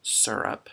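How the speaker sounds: "syrup" as SUR-up; own "syrup". Shown as a demonstration. Restating own way, "syrup" as SUR-up. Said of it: "it's not syrup".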